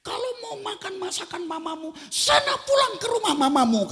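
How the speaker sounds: high, loud mock yell of an angry wife; wife snapping back after being compared to his mother; angry wife shout. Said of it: "katanya begitu".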